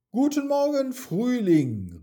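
high, loud greeting; excited calling out. A narrator's reading. Mia greets the spring as she whirls in sunshine, calling out. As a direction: call it out brightly to the spring. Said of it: "rief sie".

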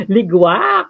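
ligwak called out bright and loud; loud playful ligwak; exclaimed catchphrase. Acted. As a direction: punch out ligwak like a game-show buzzer.

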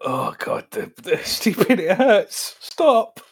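high, pained pleading; mock agony. Friend begging the others to stop the stupidity, high mock agony. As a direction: plead as if the stupidity physically hurts.

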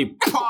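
high splat with a laugh; cartoon splat; burst noise. Watermelon bursts.

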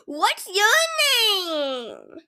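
high, slow, sing-song cashier voice; overly cheerful cashier. Acted. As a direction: gush like a too-sweet fast food worker. Cashier asks customer's name.

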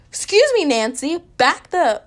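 high, loud sassy snap; sassy outburst. Pretend. To the rushing girl behind her, loud high sass. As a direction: tell her off with attitude.

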